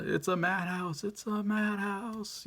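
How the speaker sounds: high, slow, anguished Heston-style outcry; Charlton Heston impression. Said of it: "one of the best guys when it comes to delivering lines".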